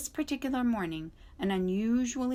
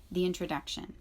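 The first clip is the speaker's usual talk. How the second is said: usual speaking voice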